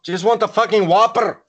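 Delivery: mock Irish rolled R on "Whopper". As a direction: roll the R hard, jokey Irish. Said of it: "Just 'cause you roll your R".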